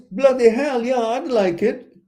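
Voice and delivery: eager yes. Pretend. Host voices what a woman really means about gifts, eager and loud. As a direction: burst out with it, high and loud. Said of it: "It means".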